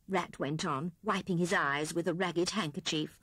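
usual speaking voice

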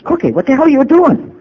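high, loud shout of outrage; angry yell. Retold or imitated. Sponsor yelling at Adele who barged into his room, high loud angry shout. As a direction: bellow at the intruder in disbelief.

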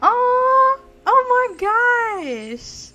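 high, stretched-out swoon; delighted cooing. Real, not acted. Moved by cute couple.

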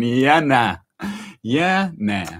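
loud imitation Australian "yeah, nah"; hammed-up Aussie twang; Aussie accent. Imitating what everybody there says, Aussie accent. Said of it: "Everybody's just saying".

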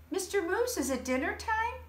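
a small hopping critter asking brightly; Rabbit. Acted. Rabbit asking Mister Moose about dinner, high and eager.

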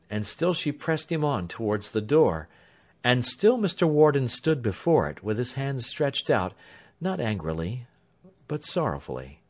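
plain reading voice

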